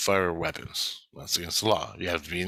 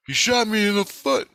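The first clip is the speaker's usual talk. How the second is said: imitating a whiny complainer, much higher and a bit louder; whiny complaint; 12 semitones higher, 4 dB louder than usual. Christ complaining to the Legion about being shot: high whiny mock complaint. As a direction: tattle like a hurt kid. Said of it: "He goes".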